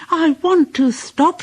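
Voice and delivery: high pleading engine voice; high weary whine; tired Percy. A narrator's reading. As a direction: plead to stop. Percy wants to stop.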